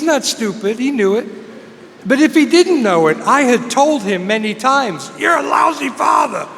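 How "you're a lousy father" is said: higher-pitched retold shout; shouted accusation. Son telling his dad off many times, shouting. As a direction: throw the blame at him, raised voice. Son's repeated accusation to father, acted.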